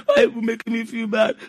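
hammed-up tearful guilt trip; imitating a crying, emotionally blackmailing latecomer; mock crying. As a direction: pretend to sob through the complaint. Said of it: "She will cry sometimes and blackmail them".

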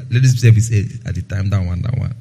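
low, muttered mockery of a distracted churchgoer; mock the phone-scrolling youth in a low mutter. Preacher mocks distracted young people with a low mutter.

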